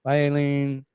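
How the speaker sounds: ordinary talk, nothing marked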